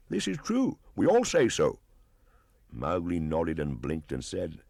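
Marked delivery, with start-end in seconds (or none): 0.1-1.8 very high, loud chorus of agreement; monkeys shouting together. Acted. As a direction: yell it as a whole troop at once. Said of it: "they would all shout together".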